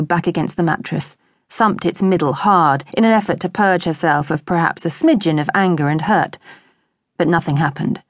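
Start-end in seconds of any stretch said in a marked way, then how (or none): none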